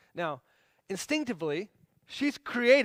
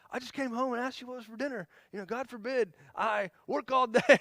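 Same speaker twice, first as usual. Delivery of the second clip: high, indignant husband imitation; mock yell; 6 semitones higher than usual. Husband yelling back about asking for dinner, mock yell. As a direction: play-act an aggrieved protest, breaking into a laugh. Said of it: "I can yell and be like".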